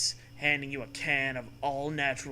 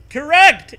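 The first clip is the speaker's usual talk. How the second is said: loud, much higher exclamation; loud high hammed-up correct; crying out correct; 12 semitones higher, 16 dB louder than usual. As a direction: shout it triumphantly high. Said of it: "it cries".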